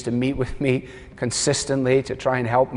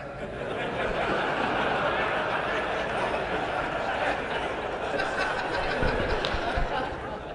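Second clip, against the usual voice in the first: laughter; 9 semitones higher, 8 dB quieter than usual. Real, not acted. Audience laughs after the joke.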